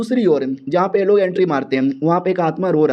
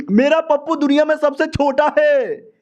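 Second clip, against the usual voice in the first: mantra shouted out; 9 semitones higher than usual. Acted. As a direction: declaim it like a battle cry, much higher. Forced to shout embarrassing mantra.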